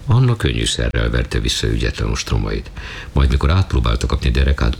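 plain reading voice

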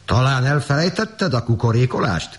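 high, indignant call without much volume; storybook farmer's mild shout; farmer's exclamation. A narrator's reading. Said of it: "kiáltott rá a gazda".

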